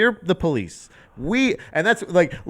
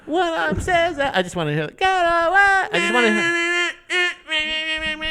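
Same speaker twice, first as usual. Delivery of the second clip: high, strained imitation of a yelling singer; yelling frontman; 13 semitones higher, slower than usual. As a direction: wail drawn-out words like a punk frontman. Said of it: "hear him yell at me".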